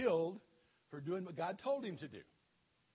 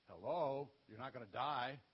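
a lower divine voice, lightly done; booming God voice; 8 semitones lower than usual. Acted. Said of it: "Maybe God didn't do it quite like that".

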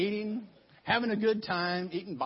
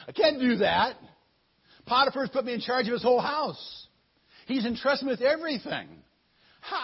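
flustered refusal; 4 semitones higher, 8 dB louder than usual. Acted. Joe refuses the wife.

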